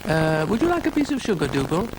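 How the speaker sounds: put-on high, friendly girlish offer; lightly voiced girl. A narrator's reading. Florence offers Dougal some sugar, high girlish voice. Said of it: "said Florence".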